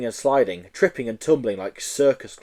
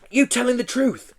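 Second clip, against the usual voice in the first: put-on boy voice; 12 semitones higher than usual. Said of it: "asked Joe".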